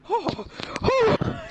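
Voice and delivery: high, loud shocked gasp. Acted. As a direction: gasp like a startled grandma. Grandma ghost shocked.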